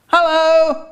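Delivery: high, put-on greeting; funny voice. Greeting the neighbour in the garden, funny high voice.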